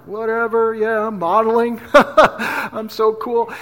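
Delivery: drawn-out, self-mocking nonchalance with a fake laugh; hammed-up cool. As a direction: act far too cool to care. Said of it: "outside, I'm like".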